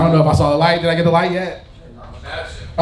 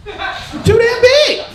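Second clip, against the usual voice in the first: squeaky exaggeration; much slower than usual. Exaggerated punchline.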